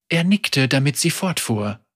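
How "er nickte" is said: plain reading voice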